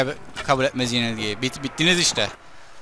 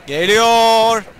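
higher, louder warning; sudden loud alarm; alarmed shout; 7 semitones higher, 7 dB louder than usual. Real, not acted. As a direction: yell that it's coming. Enemy charging at him.

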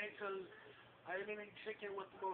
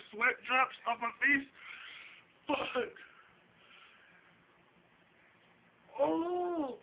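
pained outcry; 5 semitones higher, 9 dB louder, slower than usual. Real, not acted. In pain from hot sauce.

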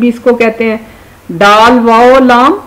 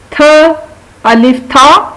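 normal talking voice

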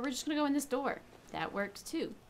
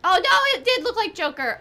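loud, high burst of surprise; real surprise, pitched way up; startled exclamation; 10 semitones higher, 9 dB louder than usual. Real, not acted. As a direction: blurt it out, amazed. Startled by the changed billboard.

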